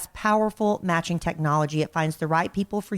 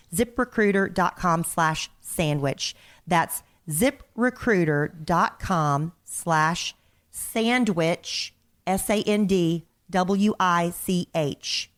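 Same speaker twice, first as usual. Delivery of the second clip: slow, deliberate ad address; slow spelled-out web address; much slower than usual. Shown as a demonstration. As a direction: spell it out very slowly. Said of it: "spelled out slowly".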